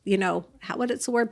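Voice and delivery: ordinary delivery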